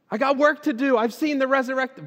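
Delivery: high, loud burst of zeal; fired-up declaration. Acted. Believer who has seen the resurrected Lord, fired up.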